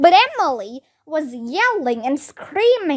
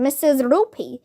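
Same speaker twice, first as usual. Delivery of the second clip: ordinary delivery, nothing marked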